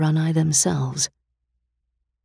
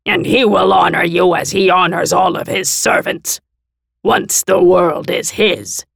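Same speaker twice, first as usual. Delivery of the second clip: scornful monster envoy; 4 semitones higher, 6 dB louder than usual. A narrator's reading. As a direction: sneer down at blasphemers.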